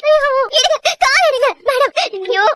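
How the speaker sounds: high-pitched anguished begging; desperate pleading. Acted. Prisoner begging warden to lift her foot, desperate. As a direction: cry out in panicked appeal.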